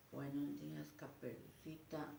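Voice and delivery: put-on timid child. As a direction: murmur the wolf line timidly. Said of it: "No, no, no, no".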